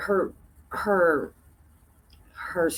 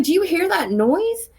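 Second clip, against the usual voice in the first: high, slow nervous ask; slow, wary question pitched high; uneasy question; 8 semitones higher than usual. Retold or imitated. Asking her friend about the noise in the dark, uneasy.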